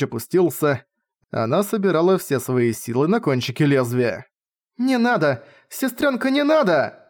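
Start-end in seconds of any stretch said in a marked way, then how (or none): 4.8-7.0 high pleading scream. A narrator's reading. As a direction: cry out high and desperate. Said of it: "закричала Ирис".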